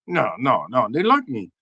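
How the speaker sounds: ordinary delivery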